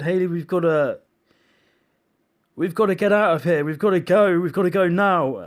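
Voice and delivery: husband urging wife Hayley to flee; urgent panic. Retold or imitated. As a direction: hurry her out, frightened.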